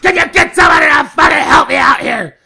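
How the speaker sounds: loud, high-pitched retold plea; exasperated yell. Customer begging a clerk to get someone else, exasperated yell. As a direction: burst into a frustrated shout.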